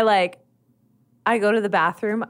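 ordinary delivery

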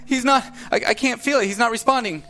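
high, loud desperate shouting; anguished denial. Acted. NPC over unresponsive friend.

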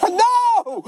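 very high hammed-up wail; mock crying. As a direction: sob out a comic refusal. Acts out crying breakdown.

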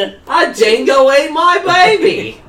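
loud, high, slow Australian wail; hammed-up Australian cry of alarm; mock Australian accent. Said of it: "I'll give you the dingo reference".

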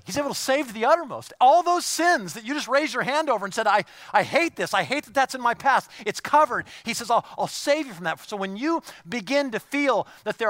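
loud, high, driving sermon voice; fired-up pulpit preaching; preaching at full force. Acted. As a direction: preach it out to the whole room, pressing hard.

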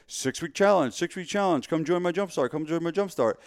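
high, repetitive promo chant; mock sales pitch. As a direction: parody a gym owner plugging an offer. Mimics repetitive gym sales pitch.

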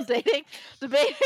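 giggling self-correction, high; laughing correction. Real, not acted. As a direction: laugh off the slip. Laughs at own slip.

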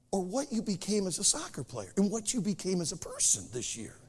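usual speaking voice

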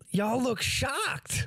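high, slow, drawn-out taunt; mocking redheaded kid. Retelling the prank video to friends, high smug drawl. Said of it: "and he's like".

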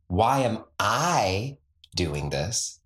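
slow echo with "I" stressed and rising. Shown as a demonstration. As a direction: lean on "I". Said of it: "emphasis on different words".

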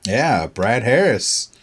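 ordinary delivery

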